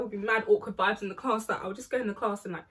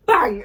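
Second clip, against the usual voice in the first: shouted much louder mock bang; loud door bang; 13 dB louder than usual. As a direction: slam the word like the door. Door slam in the story.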